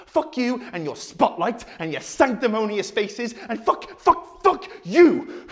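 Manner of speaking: high-pitched, building rage; furious tirade. Acted. Speaker blaming the watching audience, high furious tirade.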